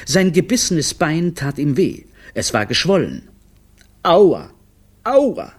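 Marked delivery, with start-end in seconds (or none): 4.0-5.5 high, loud "ow" cries; hammed-up moan of hurt; pained wailing. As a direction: wail in pain, pitch jumping up. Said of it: "jammerte er vor sich hin".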